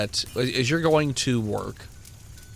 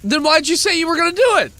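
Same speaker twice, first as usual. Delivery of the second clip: angry; 6 dB louder, faster than usual. Acted. Sheriff angrily confronting.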